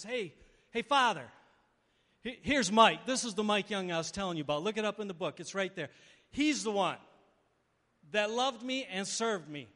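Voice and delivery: loud, ringing introduction; proud announcing. Pretend. Jesus presenting Mike to the Father, loud and proud. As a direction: present him proudly to the whole court.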